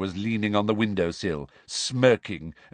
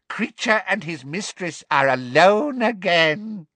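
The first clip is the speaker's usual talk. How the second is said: high, slow, gloating elf; gleeful elf; 9 semitones higher than usual. Acted. Elf rejoices at being alone with mistress, gleefully. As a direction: savour it slowly, pitched high. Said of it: "he said gleefully".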